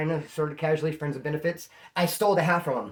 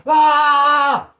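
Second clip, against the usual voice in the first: loud, high frustrated shout; angry yell; 10 semitones higher, 12 dB louder than usual. Retold or imitated. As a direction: bellow out your rage. Angry at girlfriend driving off.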